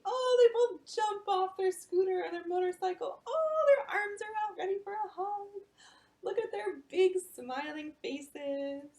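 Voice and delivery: high, loud delight; thrilled storyteller, high and loud; joyful narration. A narrator's reading. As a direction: burst with happiness.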